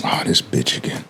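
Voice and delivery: whispered under the breath; a private grumble, barely voiced; muttered thought. Inner thought about the cashier.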